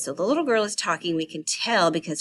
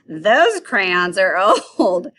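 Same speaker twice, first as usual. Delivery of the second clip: slow, louder, mock-snooty put-down; sassy; 10 dB louder, much slower than usual. Girl taunting her friend about crayons, slow sassy. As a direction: sneer it at your friend, slowly. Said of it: "That's kinda sassy".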